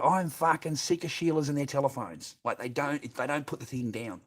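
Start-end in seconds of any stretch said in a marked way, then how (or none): 0.0-2.4 louder, higher, exasperated; fed-up rant. Real, not acted. Complaining to the boys about phones, exasperated. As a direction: spit out the complaint.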